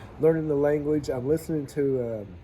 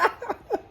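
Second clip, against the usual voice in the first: high laugh. Real, not acted. Laughing at her remark.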